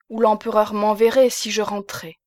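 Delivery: ordinary delivery, nothing marked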